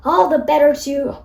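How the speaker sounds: wolf roar building to a loud shout. Acted. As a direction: pounce with a booming roar. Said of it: "roared the wolf".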